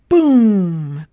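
loud, drawn-out boom; big storybook sound effect; booming crash. A narrator's reading. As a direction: boom it out like a collapse.

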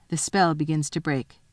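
ordinary delivery

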